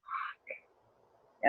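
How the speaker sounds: whispered swear, drawn out; inner thought. Retold or imitated. Pregnant woman thinking in her head, whispered dismay. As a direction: curse silently to yourself under your breath. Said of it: "thinking in my head".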